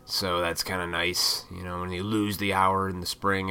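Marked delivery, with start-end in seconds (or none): none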